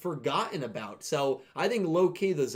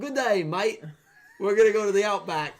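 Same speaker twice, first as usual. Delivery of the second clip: loud, high-pitched, bad Australian accent; 6 semitones higher, 6 dB louder than usual. Shown as a demonstration. Co-host showing off on request, hammed-up Australian accent. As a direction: ham up a horrific Australian accent. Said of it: "Do you want me to do my Australian accent?".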